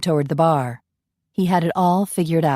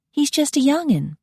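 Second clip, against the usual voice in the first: much higher Southern belle scoff; incredulous belle; 9 semitones higher than usual. Acted. Coralie doubting Ian, much higher belle voice.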